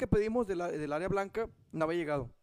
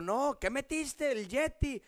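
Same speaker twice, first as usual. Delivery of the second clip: higher accusing question; mock angry; 7 semitones higher, much slower than usual. Wife interrogating him about the microwave, mock angry. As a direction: imitate the angry wife, lightly. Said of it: "bien enojada".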